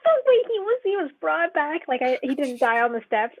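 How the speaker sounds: high, loud burst of surprise, settling as it goes; astonished protest. Real, not acted. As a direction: blurt it out in high-pitched disbelief. Learning a character survived, reacts.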